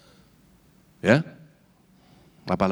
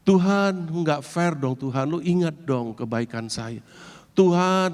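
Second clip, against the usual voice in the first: tearful plea; 7 semitones higher, slower than usual. Acted. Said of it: "Sambil menangis, dia juga ngomong".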